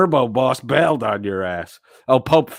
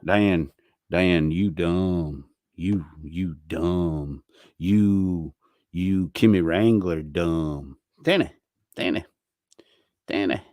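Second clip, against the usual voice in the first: mock slow taunt; 5 semitones lower, slower than usual. Mocking Dan.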